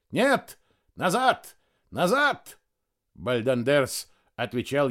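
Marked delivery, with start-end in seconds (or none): 0.1-2.6 high urgent stage shout. A narrator's reading. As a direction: fend him off, yelling. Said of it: "закричал". (отчаянный крик)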